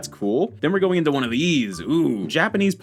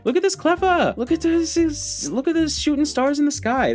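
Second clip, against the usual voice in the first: cutesy cooing. Real, not acted. Card opener coos at a cute Cleffa card in a high cutesy voice. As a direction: coo over it like it's adorable.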